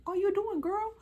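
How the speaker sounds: high incredulous squeal at the fighters. Retold or imitated. Watching the girls fight, high incredulous squeal. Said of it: "And I'm just like".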